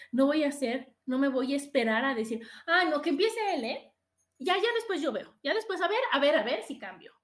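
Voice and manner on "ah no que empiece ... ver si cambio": loud, higher-pitched mimicked excuse; hammed-up holdout, pitched up and loud; mock stubborn refusal. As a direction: imitate someone refusing to go first. Mimics someone waiting for partner first.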